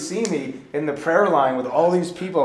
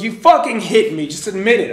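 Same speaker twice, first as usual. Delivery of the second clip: loud accusation; 7 semitones higher, 10 dB louder than usual. Retold or imitated. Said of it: "He goes".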